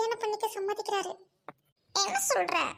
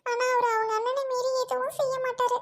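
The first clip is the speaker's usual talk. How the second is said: ordinary talk, nothing marked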